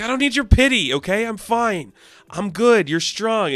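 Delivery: imitating a prickly Bruce pushing her away; mock defensive snap. Bruce snapping at Diana.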